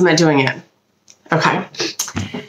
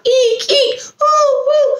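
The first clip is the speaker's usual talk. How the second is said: tiny high squeak like a squeaky shoe; rubbery high squeaky toy; slower than usual. Pretend. Squeak and owl recap.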